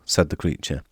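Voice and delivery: plain reading voice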